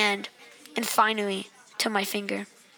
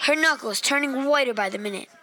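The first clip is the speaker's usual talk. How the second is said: ordinary delivery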